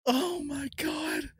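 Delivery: slow, high-pitched exclamation; stunned disbelief. Real, not acted. Collector staring at an almost three-hundred-dollar card, stunned. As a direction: draw it out high, overwhelmed. Overwhelmed by the pull.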